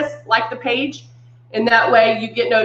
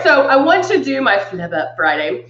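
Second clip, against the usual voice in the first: ordinary delivery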